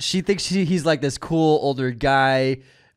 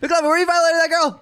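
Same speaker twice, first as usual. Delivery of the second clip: high, fast outraged cry; mock accusing yell; 4 dB louder, faster than usual. Friend catching McLovin with a girl, mock outrage. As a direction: imitate a scandalized holler.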